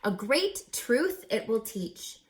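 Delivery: ordinary delivery, nothing marked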